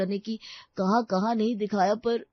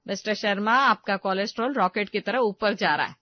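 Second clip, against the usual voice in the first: mock doctor voice; 5 dB louder than usual. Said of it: "वो doctor ने क्या कहा था".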